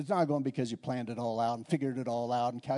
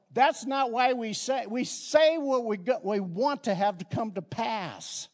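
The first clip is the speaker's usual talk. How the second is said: raised, emphatic preacher delivery; impassioned preaching; 6 semitones higher, 4 dB louder than usual. Real, not acted. Preacher teaching on words as seeds, fervent preaching. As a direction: drive the principle home to the congregation.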